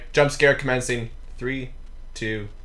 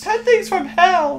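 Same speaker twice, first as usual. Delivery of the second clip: put-on little girl voice; slower than usual. Joins the mock girl reading.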